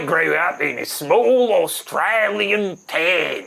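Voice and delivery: broad, drawn-out mock Australian; exaggerated Australian twang; Australian accent. Shown as a demonstration. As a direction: lay the Aussie vowels on thick and slow. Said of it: "you gotta do the accent now".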